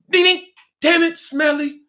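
high, bell-like ding then a squeaky curse; mock dazed thoughts. Mid-air, cursing his friend Smelly in a high voice. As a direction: play it cartoon-dazed. Retold thoughts during crash.

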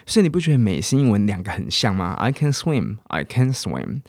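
ordinary talk, nothing marked

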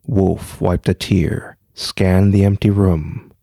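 low, even storytelling voice; story narration. A narrator's reading. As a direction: read it like a spooky tale. Reads a ghost story aloud.

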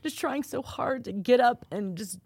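high, slow, tearful voice; struggling to speak through tears. Real, not acted. Host, moved by his friend's post, speaking through real tears. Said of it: "I'm in tears".

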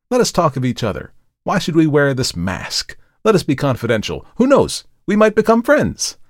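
high, urgent, fervent speech; cling to the conversation with rising urgency; intense, talkative Markheim. Acted.

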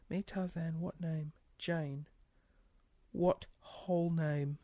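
soft, slow puzzled reread; quiet reread. Real, not acted. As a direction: mutter it while working it out. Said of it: "I don't get that".

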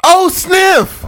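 high, gasping exclamation; delighted amazement. Real, not acted. Co-host thrilled by the announcer read, amazed. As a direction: gasp and squeal, impressed.

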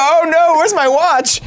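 high, loud cartoon panic; hammed-up squeaky alarm; mock panicked finger. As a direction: yelp it like a tiny lost finger. Voicing the lost finger.

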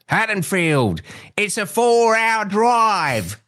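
slow, high Cockney Loomis; Cockney accent. Retold or imitated. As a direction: let the Cockney slip out, drawn-out. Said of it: "the Cockney in him comes out".

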